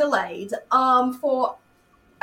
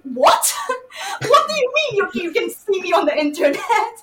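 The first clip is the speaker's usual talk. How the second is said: laughing disbelief; 8 semitones higher, 5 dB louder than usual. Retold or imitated. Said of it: "and she's like".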